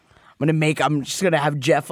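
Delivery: ordinary talk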